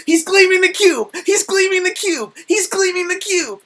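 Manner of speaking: put-on a kid thrilled by the movie, yelling it out; excited shouting at the screen. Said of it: "and I'm like".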